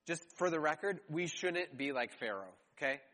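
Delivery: ordinary delivery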